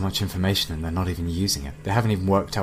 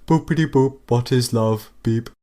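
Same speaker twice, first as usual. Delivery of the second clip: higher, louder put-on machine voice; fake robot voice; 4 semitones higher, 6 dB louder than usual. AI putting on robot voice to ask its creators. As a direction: play innocent robot. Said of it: "rehearsed computer noises you're making".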